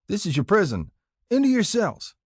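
put-on bossy older boys. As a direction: order the captives in like a playground boss. Older boys ordering captives into boxes.

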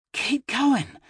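put-on high, drowsy little girl voice; sleepy girl mumbling. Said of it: "the sleepy scrap of a girl mumbled".

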